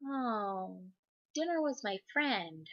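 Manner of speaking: small, softly wistful imitation of a child; sad little kid. Little girl hearing her pig friend ran away, softly sad.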